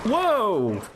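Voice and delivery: high startled yelp; real jolt of alarm, pitch leaping. Real, not acted. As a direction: cry out in sudden surprise. Game enemy startles him.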